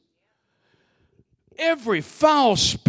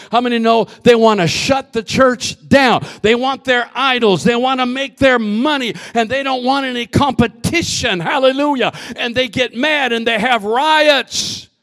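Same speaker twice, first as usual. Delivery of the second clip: louder, driving sermon rhythm; fiery preaching; 3 semitones higher, 5 dB louder than usual. Real, not acted. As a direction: preach it hard to the church. Preaching at full force.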